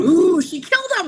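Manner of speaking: high, loud burst of excitement; a gleeful gasp of a guess; excited gasp. Real, not acted. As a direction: jump in thrilled at the twist. Gasping guess at the book's twist.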